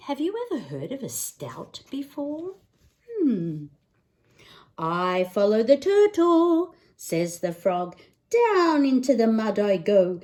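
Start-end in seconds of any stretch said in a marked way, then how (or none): 4.8-10.2 lively picture-book read-aloud; singsong bedtime book voice; reading to children. Acted. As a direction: read it like storytime for a little one.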